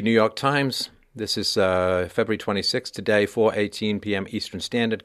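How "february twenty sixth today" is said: normal talking voice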